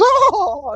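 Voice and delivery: high falsetto "oh" of a struck cat; drawn-out high mock groan; cat's cry when smacked. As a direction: cry out high as you topple. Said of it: "He goes".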